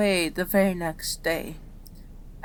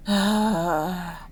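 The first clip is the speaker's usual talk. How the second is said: long exhaled "ah"; resigned sigh. Acted. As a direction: let out a long, weary sigh. Resigned about harder job.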